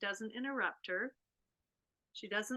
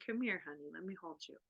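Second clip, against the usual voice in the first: soft tender invitation; 4 semitones lower, 9 dB quieter, much faster than usual. Retold or imitated. Mother's calm invitation.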